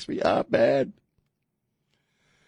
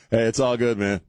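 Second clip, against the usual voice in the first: normal talking voice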